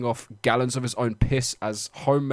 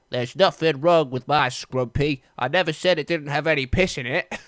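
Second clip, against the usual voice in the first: put-on West Country accent; 4 semitones higher, 5 dB louder than usual. Reading a quote from the man.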